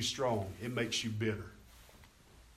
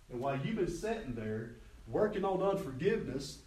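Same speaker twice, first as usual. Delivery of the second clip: normal talking voice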